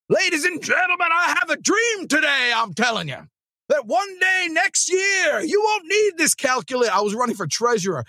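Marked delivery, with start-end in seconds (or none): 0.1-6.9 high, booming preacher-style oratory, slowing on the big lines; mock a famous civil-rights speech at a school podium; grand oration. Teen treasurer candidate addressing classmates, hammed-up grand orator shout. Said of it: "I started the speech that way".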